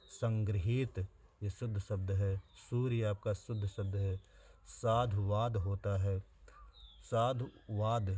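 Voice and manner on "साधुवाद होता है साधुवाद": साधुवाद said slowly with a clear व; slow spelling-out. Shown as a demonstration. Teacher drilling exam spelling, slow and deliberate. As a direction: stretch साधुवाद out so the व is heard. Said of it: "वाह रहेगा".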